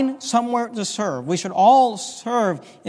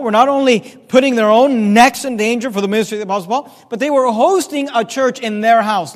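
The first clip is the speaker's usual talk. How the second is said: loud, projected pulpit delivery; forceful preaching; 2 semitones higher, 7 dB louder than usual. Acted. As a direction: drive each phrase home to the congregation.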